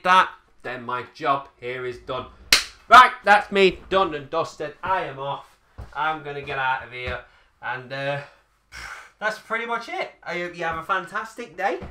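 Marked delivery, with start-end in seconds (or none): none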